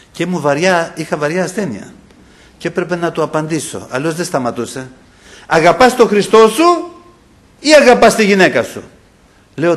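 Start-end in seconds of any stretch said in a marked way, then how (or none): 5.5-8.8 loud, high, pressing interrogation; Satan's challenge. Retold or imitated. Preacher voices Satan testing him over his wife, loudly challenging. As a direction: demand a choice as the tempter. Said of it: "άκουγα τη φωνή του".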